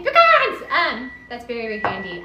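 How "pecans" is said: more excited. Real, not acted. Repeats louder, higher.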